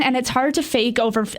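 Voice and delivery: usual speaking voice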